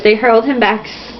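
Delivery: usual speaking voice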